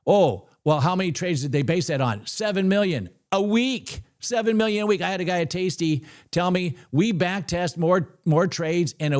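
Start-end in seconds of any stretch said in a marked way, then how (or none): none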